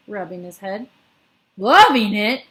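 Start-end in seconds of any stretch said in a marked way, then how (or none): 1.6-2.4 loud outraged yell. A narrator's reading. Bloodbottler yells back at the BFG, outraged. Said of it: "yelled the bottler".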